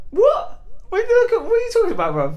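loud, very high disbelief; incredulous. Retold or imitated. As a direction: protest a baffling accusation, re-enacted. Acting out his disbelief at accusation.

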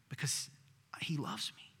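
breathy whisper of "he loves me"; soft tender whisper. Real, not acted. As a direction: whisper it like a secret. Unvoiced tender aside.

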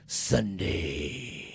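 booming monster truck announcer. Acted. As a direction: hype it like a monster truck commercial. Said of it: "part of the show was brought to you today by".

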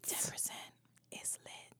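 secretive hushed whisper. Pretend. Hushed hype about discount.

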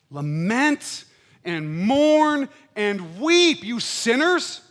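loud, very slow drawn-out lament; slow preached call. Acted. Stretch every word, full voice. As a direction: pastor echoing James's command to sinners, slow forceful preaching. Repeating the verse slowly, loud.

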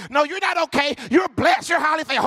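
high, emphatic church-folk scolding; mock over-pious pep talk; pushy correction. Acted over-pious friend corrects him.

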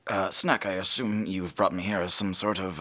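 normal talking voice

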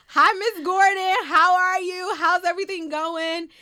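high, loud, cheery hello; overeager phone greeting. Retold or imitated. Student answering her teacher's dreaded call, overeager greeting. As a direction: greet her brightly, putting on a front.

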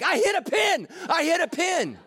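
high, thrilled cry about the pin; celebrating a tiny success; excited bowler. Retold or imitated. Preacher mocking himself at bowling, thrilled and high. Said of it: "I'm like".

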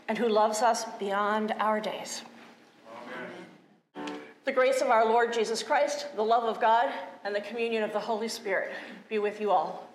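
ordinary talk, nothing marked

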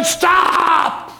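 high, drawn-out cry of command; acted leader cutting through a wailing crowd; called-out halt. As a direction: call "stop" out long and high. Voices leader halting the wailing crowd.

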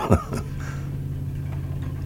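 rire soufflé, sans voix; un petit rire las qui s'échappe; rire étouffé. Acted. Rire seul dans le garage. (rire las, presque silencieux)

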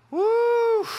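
high, loud church whoop; joyful preacher woo; excited whoop. Real, not acted. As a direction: whoop to fire the room up. Preacher whoops, raising energy.

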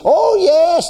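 exultant outburst. Real, not acted. Exultant cry mid-sermon.

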